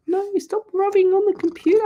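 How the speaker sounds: high, quick voice telling off a pet; cutesy high-pitched telling-off; playful pet scolding. Real, not acted. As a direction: scold the cat in a squeaky-high rush.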